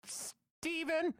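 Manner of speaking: very high squeaky falsetto; comic little-kid squeal; creepy kid answering. Acted. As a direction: squeak the name out like a fake child. Child answers with parent's name.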